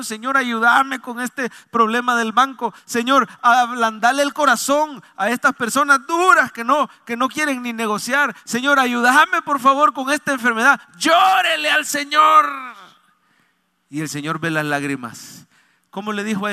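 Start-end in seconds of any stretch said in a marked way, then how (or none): 0.0-10.8 loud, raised pleading to God; high-pitched, forceful supplication; fervent prayer. Shown as a demonstration. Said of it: "más fervor le tenemos que meter". 11.0-12.5 long, high preacher's shout; loud, stretched-out urging; fervent exhortation. Real, not acted. Preacher exhorts congregation to cry out.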